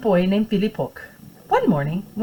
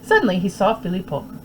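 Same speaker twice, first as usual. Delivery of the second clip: plain reading voice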